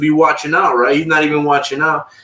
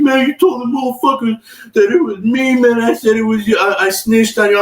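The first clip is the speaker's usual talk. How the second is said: mocking whiny cellmate; 9 semitones higher than usual. Cellmate confronts him.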